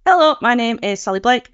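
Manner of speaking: usual speaking voice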